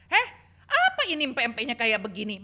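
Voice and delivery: loud, fast, sharp rebuke; scolding mother-in-law. Retold or imitated. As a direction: tell her off over the food. Mother-in-law scolding new bride.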